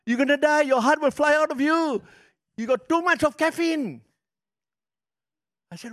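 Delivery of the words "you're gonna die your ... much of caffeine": high, loud mock-doctor warning; doctor's warning. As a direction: play the doctor scolding him. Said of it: "I say".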